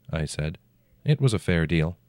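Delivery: usual speaking voice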